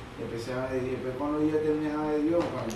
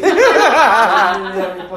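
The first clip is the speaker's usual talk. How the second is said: high, loud burst of laughter; 5 semitones higher, 16 dB louder than usual. Real, not acted. Man reacting to joke about owed money, loud laugh.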